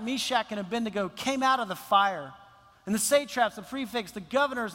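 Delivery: ordinary delivery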